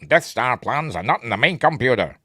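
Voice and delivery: put-on stormtrooper voice. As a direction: imitate a film soldier giving a report. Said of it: "a stormtrooper comes up".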